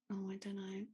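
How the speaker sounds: barely voiced, hesitant aside; undecided whisper. Real, not acted. Podcast host torn over a driver's grade, undecided whisper.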